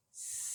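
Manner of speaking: long soft hiss like a snake; drawn-out s like a snake. Shown as a demonstration. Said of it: "sounds a bit like a snake".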